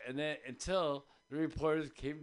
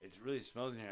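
low, hushed reporter voice; quiet disgust; 8 semitones lower, 8 dB quieter than usual. Retold or imitated. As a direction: mutter it, put off by the smell. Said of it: "he's like".

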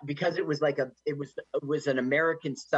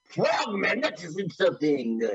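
put-on higher, offended French accent; mock French indignation; 4 semitones higher than usual. The offended French frogman, a higher mock French accent. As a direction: play the insulted Frenchman. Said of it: "Make it sound more French".

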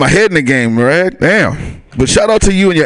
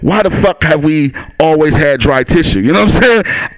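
ordinary delivery

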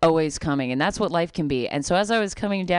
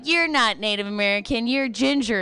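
scoffing heckler; 6 semitones higher than usual. Retold or imitated. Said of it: "people would be like".

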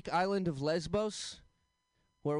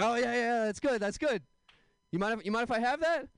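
higher, excited acted reply; mock eager friend; 7 semitones higher than usual. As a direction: jump on the idea, pitched up. Acts friend seizing on the idea.

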